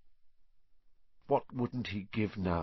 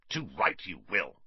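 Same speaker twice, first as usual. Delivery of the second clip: a lower, firm uncle voice; forceful; 4 semitones lower than usual. A narrator's reading. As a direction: lay down the law. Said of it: "said Uncle Vernon forcefully".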